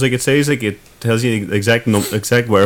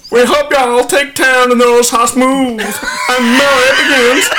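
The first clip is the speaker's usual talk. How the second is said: put-on Kentucky accent; 12 semitones higher than usual. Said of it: "You should be reading it in a Kentucky accent".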